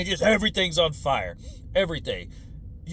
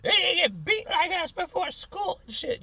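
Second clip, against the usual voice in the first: much higher mocking old-timer voice; grumpy old-timer; 12 semitones higher than usual. Old-timers griping about the young, high mocking sneer. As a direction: sneer like an old man at kids. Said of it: "everybody's always like".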